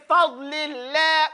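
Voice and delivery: "fadlillah" said slowly, light vowel after f. Shown as a demonstration. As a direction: draw out "fadlillah" with a light fa as in "family". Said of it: "No, it's not fad".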